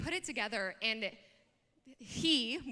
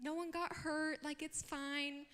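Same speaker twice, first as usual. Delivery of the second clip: softer, slow, gently soothing; reassuring mom; 3 semitones higher, 8 dB quieter than usual. Retold or imitated. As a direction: imitate a calm mom smoothing it over. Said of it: "my mom, bless her heart, she's like".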